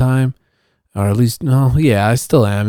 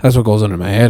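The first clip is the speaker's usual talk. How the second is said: ordinary delivery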